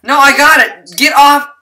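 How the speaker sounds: loud frantic shouting. Real, not acted. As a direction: yell them away. Shooing friend from chickens.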